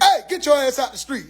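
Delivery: sharp, half-shouted father's order; mock fatherly yell. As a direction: imitate a dad ordering his son inside. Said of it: "He ain't have to yell".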